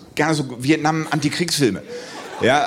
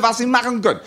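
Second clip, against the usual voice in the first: ordinary talk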